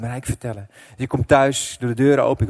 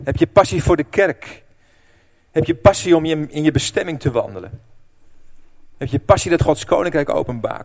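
louder, searching questions to the church; preacher's appeal; 4 semitones higher, 6 dB louder than usual. Acted. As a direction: press each listener personally. Appealing to congregation.

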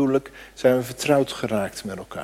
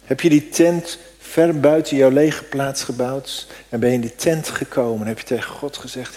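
ordinary delivery

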